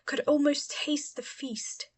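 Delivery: usual speaking voice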